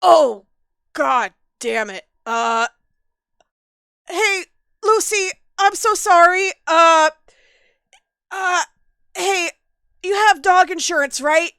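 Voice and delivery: high, loud, near-crying panic; horrified he's hurt the dog, voice cracking; panicked barker. Acted. Barker apologising to Lucy in loud panic. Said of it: "the barker goes".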